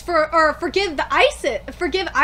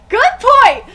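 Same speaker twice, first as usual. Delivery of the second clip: emphatic shout; 6 semitones higher, 11 dB louder than usual. Real, not acted. Agreeing loudly with character.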